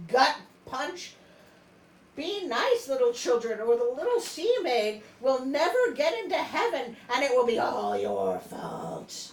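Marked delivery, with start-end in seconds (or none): 2.1-9.3 loud, laughing mock threat to kids; mock warning. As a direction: wag a finger at children, laughing. Mock moral to children.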